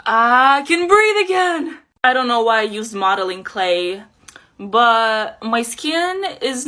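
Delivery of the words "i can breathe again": loud, slow, higher cry of relief; real joy at breathing again; relieved cry. Real, not acted. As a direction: free at last, belt it out. Relief after removing the mouthpiece.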